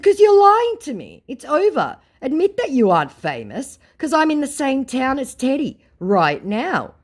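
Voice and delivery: high, loud accusing comeback; confrontational retort. Retold or imitated. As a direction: call out the liar, loudly. Acted retort to a scammer.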